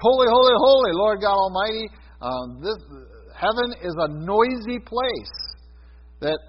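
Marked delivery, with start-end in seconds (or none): 0.0-1.9 high, loud cry of holy praise; ringing louder worship cry; loud heavenly praise. Retold or imitated. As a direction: proclaim it like the angels in Isaiah. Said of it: "when Isaiah shows up because it's".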